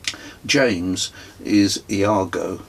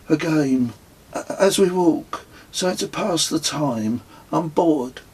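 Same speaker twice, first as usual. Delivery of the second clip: put-on higher, whiny young boy; child's voice; 8 semitones higher than usual. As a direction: play the bored nine-year-old, pleading. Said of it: "said Kez".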